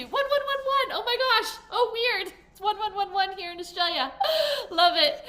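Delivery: high, loud gasp of wonder; thrilled at a sign; surprised delight. Real, not acted. Spots 11:11, surprised.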